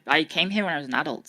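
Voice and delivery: ordinary talk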